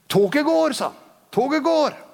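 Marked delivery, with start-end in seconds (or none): 0.1-0.8 high-pitched, drawn-out announcement; retold call. Preacher quoting the Spirit's warning, high urgent call. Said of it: "Helligånd ropte til meg". 1.3-2.0 retold call. Preacher quoting the Spirit's warning, high urgent call. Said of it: "Helligånd ropte til meg".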